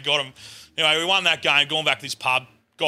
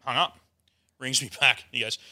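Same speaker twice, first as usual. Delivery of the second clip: normal talking voice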